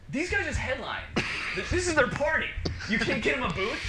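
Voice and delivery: mock-outraged plea for a booth for the band; incredulous plea. Retold plea to bar staff.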